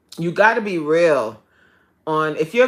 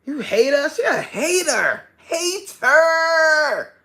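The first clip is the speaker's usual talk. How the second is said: high, drawn-out accusing; playful high accusing of the devil; mock accusing; 8 semitones higher, slower than usual. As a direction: call her a hater teasingly. Calling the devil a hater.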